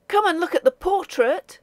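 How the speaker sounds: put-on young man urging his friend to hurry to the portrait; impatient. A narrator's reading. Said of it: "said Charlie impatiently".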